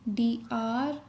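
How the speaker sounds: normal talking voice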